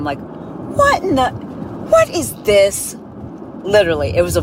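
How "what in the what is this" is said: slow, high baffled disbelief. Retold or imitated. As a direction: drag it out in confusion. Hearing a baffling voicemail.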